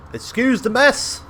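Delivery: high, loud, drawn-out shout; shouted from above. A narrator's reading. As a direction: call it down loudly from the gates. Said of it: "shouts a voice from above the gates".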